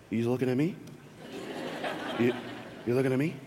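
quiet, low, hesitant question; awkward. Retold or imitated. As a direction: ask it softly with awkward gaps. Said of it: "this awkward".

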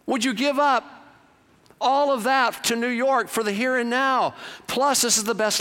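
preacher building the rope picture; preaching. Acted. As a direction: drive each phrase home.

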